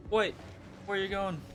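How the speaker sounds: ordinary delivery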